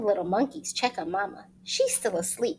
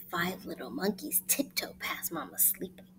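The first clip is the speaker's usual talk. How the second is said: soft sneaky whisper; sneaking in late; 5 semitones lower, 9 dB quieter than usual. Acted. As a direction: tiptoe past in a whisper. Sneaking past sleeping mama.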